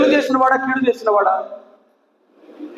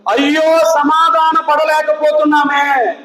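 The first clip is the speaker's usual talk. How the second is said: acted lament; 4 semitones higher than usual. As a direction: lament aloud as a preacher voicing sorrow. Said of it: "దుఃఖిస్తే దేని కొరకు దుఃఖించాలి". (దుఃఖంతో గొంతెత్తి విలపిస్తున్నట్టు)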